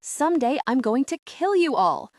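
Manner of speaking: put-on a furious girl yelling at her captors; yelled threat. A narrator's reading. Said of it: "throws her head back from Jasper and yells".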